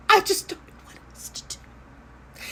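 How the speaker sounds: whispered, tearful high lament; a fussy lady whispering through fake tears; mock sob. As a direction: break into a hammed-up whimper. Owner's lament ends in tears.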